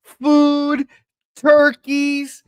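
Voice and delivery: high, loud, drawn-out hype; hyped announcement. Acted. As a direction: sell the lineup like a big event. Hyping the food race lineup.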